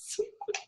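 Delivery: high burst of giggling; sudden high giggle. Real, not acted. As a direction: break into a quick high giggle.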